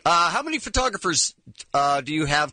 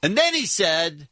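normal talking voice

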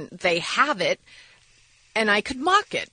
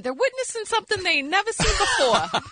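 imitating the sunfish guy; 10 semitones higher than usual. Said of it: "mocking the sunfish guy".

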